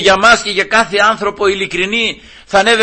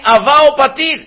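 high, raised cry; 8 semitones higher, 4 dB louder than usual. Acted. The Spirit calling to the Father, crying out. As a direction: cry it out in fervent appeal. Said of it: "φωνάζει και λέει".

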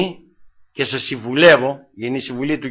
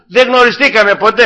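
emphatic preaching; 8 semitones higher, 7 dB louder than usual. Acted. Preacher proclaiming judgement.

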